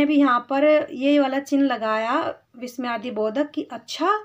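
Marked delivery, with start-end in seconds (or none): 3.6-4.2 अच्छा rising at the end; surprised question. Shown as a demonstration. Teacher showing the exclamation mark, surprised. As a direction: ask अच्छा in surprise, voice lifting. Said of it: "आश्चर्य से पूछा".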